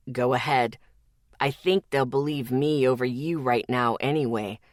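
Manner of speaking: low, even male voice; Zeke, calm and unbothered. Acted. Zeke dares Lexi to scream, low and calm. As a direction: calmly call her bluff.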